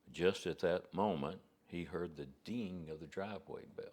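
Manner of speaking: usual speaking voice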